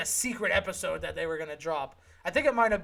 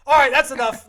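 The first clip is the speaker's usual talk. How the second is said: loud, higher-pitched cut-off; mock exasperation; 5 semitones higher, 13 dB louder than usual. Podcaster ending the name debate, loud mock exasperation.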